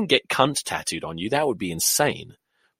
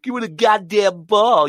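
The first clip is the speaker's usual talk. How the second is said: high, slow, exaggerated American accent; mock American accent; 8 semitones higher, 3 dB louder than usual. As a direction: parrot him in a hammed-up Yank accent.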